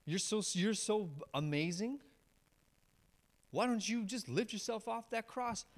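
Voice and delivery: mock the man on the cross; mocking taunt. Crowd jeering at Jesus on the cross, sarcastic mocking taunt. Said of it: "are now yelling at him".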